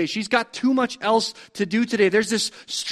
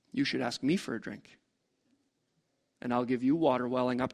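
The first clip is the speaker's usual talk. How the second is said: hushed dialogue; 7 semitones lower, 10 dB quieter than usual. Acted. Preacher retelling the woman and Jesus at the well, hushed. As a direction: voice dropped much softer for the well dialogue.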